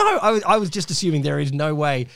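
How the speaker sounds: ordinary talk, nothing marked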